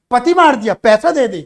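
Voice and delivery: loud, pushy demand for money; demanding widow. Shown as a demonstration. Teacher showing the student how the widow demands money, loud. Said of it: "ऐसे बोल".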